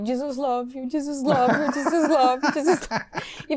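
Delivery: put-on hugging the girl, laughing through the words; laughing repeat.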